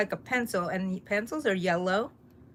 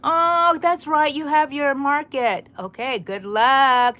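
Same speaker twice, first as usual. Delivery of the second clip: loud, higher burst of sudden remembering; excited well-wishing; 5 semitones higher, 9 dB louder, slower than usual. Real, not acted. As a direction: cheer them on with real enthusiasm. Remembers a viewer's market day.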